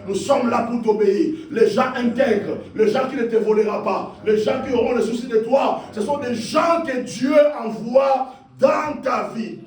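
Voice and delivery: fervent preacher calling people to pray; rousing preaching. Real, not acted. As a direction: urge the congregation on, phrase after phrase. Rouses congregation to prayer.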